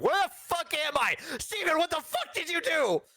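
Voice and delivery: high-pitched hammed-up panicked outburst; mock panic. Imagining waking from chloroform and yelling at co-host, mock panicked. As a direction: play waking up in a wild panic. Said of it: "I'll be panicked afterwards".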